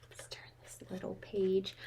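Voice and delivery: soft unvoiced whisper. Real, not acted. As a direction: whisper it so the story isn't broken. Aside while turning page.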